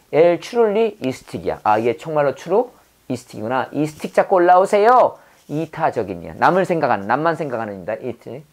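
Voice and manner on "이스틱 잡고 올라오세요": raised, louder call to someone. Acted. Teacher acts out offering a stick to climb, calling out. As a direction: call out higher, as if urging someone up.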